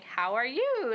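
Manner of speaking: "How are you?" with the voice rising high at the end; English question rise. Shown as a demonstration. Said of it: "upwards intonation where your voice goes high".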